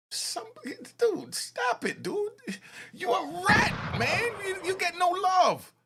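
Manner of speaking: high-pitched mock yell at the witness; mocking shout. Commentator mocks witness, high shout.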